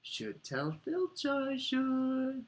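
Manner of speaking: very high, slow, sanctimonious lilt; saintly. A narrator's reading. Peeves threatening to tell Filch, saintly voice. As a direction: draw it out in a mock-pious high voice. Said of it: "said Peeves in a saintly voice".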